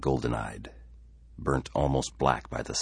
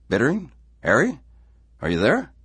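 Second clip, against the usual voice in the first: high, loud, rising questions; calling for someone; 11 semitones higher, 8 dB louder than usual. Acted. Simpson to silent caller, calling out questioningly. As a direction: check whether the caller is still on.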